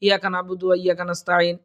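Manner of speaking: fast, run-together, no stretches; hurried recitation. Shown as a demonstration. Teacher showing how we misread in haste, rushed recitation. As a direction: rush straight through the whole verse. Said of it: "এরকম দ্রুত পড়ে তাড়াহুড়া করে".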